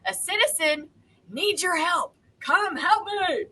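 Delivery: high-pitched calling out for help; cry for help. Acted. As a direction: call out high for help, stretching the last words.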